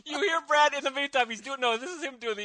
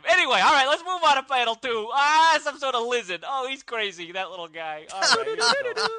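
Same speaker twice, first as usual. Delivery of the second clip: put-on New York accent; 2 semitones higher than usual. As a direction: talk like a pushy New York cabbie. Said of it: "my accent there".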